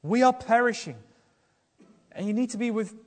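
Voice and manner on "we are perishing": loud, pleading cry to the Lord; a preacher voicing a desperate plea. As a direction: cry out for rescue. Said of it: "to cry out".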